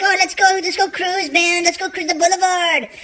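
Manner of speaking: put-on high Mexican-accented cousin. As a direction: coax him in a Mexican accent. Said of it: "He's like".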